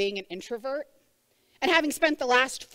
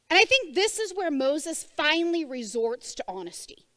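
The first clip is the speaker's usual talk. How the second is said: usual speaking voice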